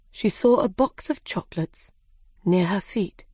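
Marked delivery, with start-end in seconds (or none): none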